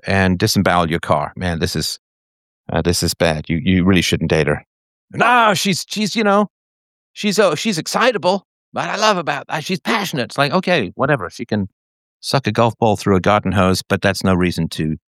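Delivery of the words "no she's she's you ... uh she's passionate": lightly voiced friend making excuses; a hint of a lovestruck bro; mock smitten guy.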